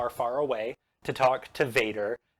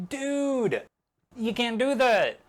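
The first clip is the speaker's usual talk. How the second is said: much higher, mock-outraged protest; 10 semitones higher, slower than usual. Obi-Wan protesting to Anakin, high mock outrage. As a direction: squeak it out in disbelief. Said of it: "And Obi-Wan is like".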